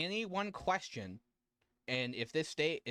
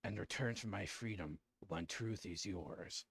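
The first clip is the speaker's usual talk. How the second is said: unearthly voice; 7 semitones lower, 8 dB quieter than usual. Acted. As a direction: speak as an ancient, hollow power. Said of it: "an eerie voice ask".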